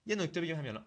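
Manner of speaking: usual speaking voice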